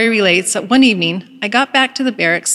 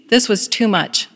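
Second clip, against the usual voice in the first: usual speaking voice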